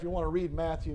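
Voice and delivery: ordinary talk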